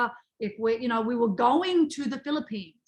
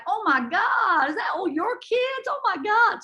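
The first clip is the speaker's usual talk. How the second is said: slow, higher, louder bad American accent; 10 semitones higher, 3 dB louder than usual. Texan resort owner shocked at all the kids, bad American accent. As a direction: gush in a hammed-up American accent. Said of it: "my American accent is really shit".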